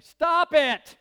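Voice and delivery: sudden high, loud shout; comically blunt yelled command; mock-gentle rebuke, shouted. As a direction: burst out loudly, as a punchline. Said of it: "is very gently and lovingly saying".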